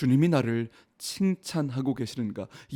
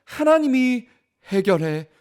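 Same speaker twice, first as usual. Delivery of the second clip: louder, higher, climbing pulpit cry; impassioned preacher driving home the point; full-force preaching; 6 semitones higher, 7 dB louder than usual. Real, not acted. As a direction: build the sermon to its climax. Climactic preaching to the congregation.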